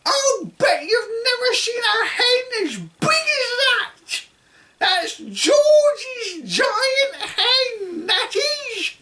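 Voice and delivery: put-on high, loud and gleeful old woman; grandma gloating from the rooftop; cackling grandma shout. A narrator's reading. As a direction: crow it with a cackle. Grandma voiced throughout.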